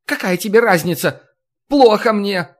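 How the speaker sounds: indignant. A narrator's reading. Necromancer indignant.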